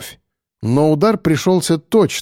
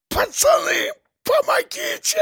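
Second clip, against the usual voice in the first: very high, softer choked wail; strangled cry for help; 4 dB quieter than usual. A narrator's reading. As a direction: beg your mates while being throttled. Strangled man begs friends for help.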